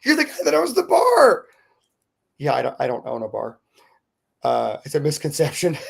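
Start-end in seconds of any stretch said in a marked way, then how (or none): 0.0-1.5 much higher, laughing fangirl squeal; excited shriek. Retold or imitated. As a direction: shriek with delight at recognizing him. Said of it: "she shrieked".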